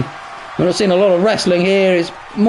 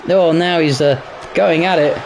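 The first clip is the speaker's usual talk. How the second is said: ordinary talk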